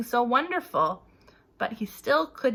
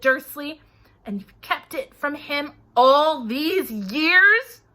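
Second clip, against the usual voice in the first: trembling with rage; 4 semitones higher, 6 dB louder than usual. A narrator's reading. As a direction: let the rage shake every word. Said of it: "his every syllable trembled with rage".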